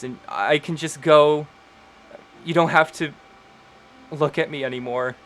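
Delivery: weeping sister begs forgiveness, rising in anguish; desperate tearful apology. Acted. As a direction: blurt out every regret while crying. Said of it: "Lenore looks up and she says".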